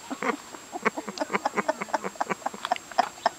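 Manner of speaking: breathless, voiceless laugh; silent wheezing laugh. Real, not acted. Teller laughs after punchline.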